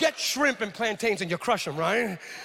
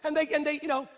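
normal talking voice

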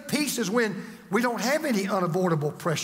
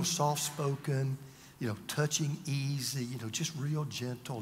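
low, hushed, gentle voice; soft-spoken; 7 semitones lower, 9 dB quieter than usual. Shown as a demonstration. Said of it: "we think about gentle about being".

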